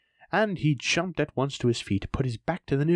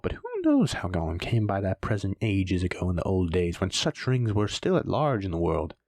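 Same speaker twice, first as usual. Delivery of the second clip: ordinary delivery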